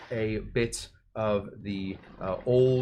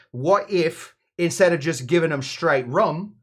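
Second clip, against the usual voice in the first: hammed-up English accent, eager pitch; mock-English accent that strays towards Australian. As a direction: do a rough, matey English bloke. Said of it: "probably with an English accent".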